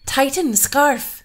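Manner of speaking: high, loud, slow order; harsh command. Acted. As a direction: bark the command to crush her. Bride tests the queen.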